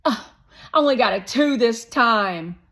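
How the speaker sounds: put-on high, loud pushy boy; Shake voice. A narrator's reading. Narrator voicing Shake, a loud, high pushy boy. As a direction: play the bossy guest kid, loud and high.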